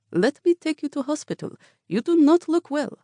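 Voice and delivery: put-on high, pleading driver with an accent; concerned cab driver. A narrator's reading. Cab driver pleading with the dazed woman. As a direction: press her kindly to go.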